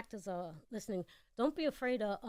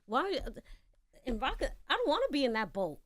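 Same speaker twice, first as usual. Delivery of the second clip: defiant pushback; 5 semitones higher, 5 dB louder than usual. Retold or imitated.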